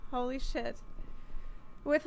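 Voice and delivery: ordinary delivery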